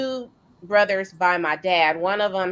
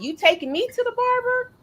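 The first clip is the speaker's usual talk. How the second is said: dad's question, pitched much higher; imitating mumbling dad. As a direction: imitate an older father asking, voice lifted high. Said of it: "He kinda mumbles now more".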